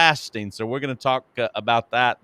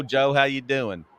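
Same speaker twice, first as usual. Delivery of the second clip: ordinary talk, nothing marked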